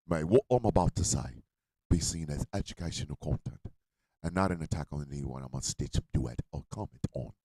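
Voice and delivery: mock Cockney, low and very soft; hushed, low take-off of a Cockney speaker; Cockney accent impression. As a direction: murmur it in an imitated Cockney accent. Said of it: "is Cockney".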